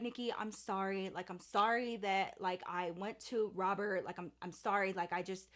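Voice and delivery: hushed weepy apology; tearful apology. Retold or imitated. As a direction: apologize while holding back tears. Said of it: "she was like".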